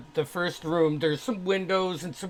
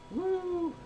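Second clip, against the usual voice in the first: excited woo; 4 dB quieter than usual. Real, not acted. GM cheers the level up.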